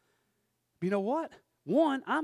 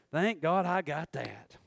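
relieved sigh; 5 semitones lower, 4 dB quieter, slower than usual. Retold or imitated. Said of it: "you'll be like".

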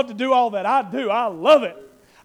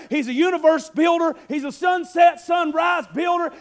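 higher, louder praise shout; preaching shout; 3 semitones higher, 3 dB louder than usual. Real, not acted. Preacher exalts God to the congregation, shouting. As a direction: ring it out to the church.

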